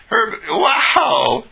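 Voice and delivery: high, stretched-out wow; incredulous wow. Acted. Told they make someone feel ten feet tall, incredulous wow. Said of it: "that's incredulous".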